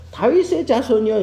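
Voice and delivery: high, loud mock call; imitated calling out. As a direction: call out to him, pitched well up. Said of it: "부를 때 어떻게 불러요". (높고 크게 부르는 외침 흉내)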